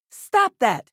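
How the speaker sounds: urgent loud protest; sharp command. Acted. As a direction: shout it to halt someone.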